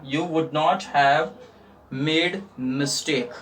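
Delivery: very slow, a pause after each word; teacher spelling out the sentence for students, very slowly; word-by-word dictation. Shown as a demonstration. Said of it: "teacher dictates word by word".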